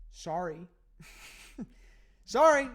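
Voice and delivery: sorry, bursting out laughing; laughing apology. Real, not acted. Breaking up while apologizing to the audience, laughing loudly. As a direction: laugh it out loud.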